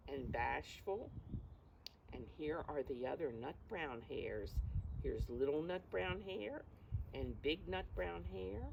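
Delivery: ordinary delivery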